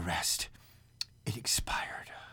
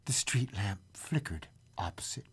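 hushed storytelling; 9 dB quieter than usual. A narrator's reading. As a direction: close the tale very quietly. Narrator's hushed ending.

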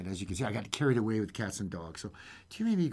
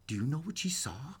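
whispered storyteller's question; secretive, soft whisper before the reveal; hushed suspense; 8 dB quieter than usual. A narrator's reading. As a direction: whisper it to draw listeners in.

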